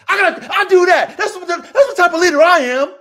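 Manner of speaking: loud, high-pitched insistence; heated boast. Real, not acted. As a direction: burst out with it, proud and forceful. Boasts of leadership, worked up.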